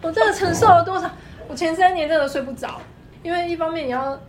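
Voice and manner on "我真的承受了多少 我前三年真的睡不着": laughing. Real, not acted. Laughing about sleepless early years.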